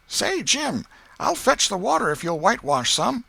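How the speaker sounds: put-on high boyish voice, wheedling; young Tom, coaxing. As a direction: sweet-talk Jim into a trade. Tom's voice in the story.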